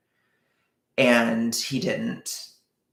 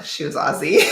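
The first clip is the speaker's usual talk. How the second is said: normal talking voice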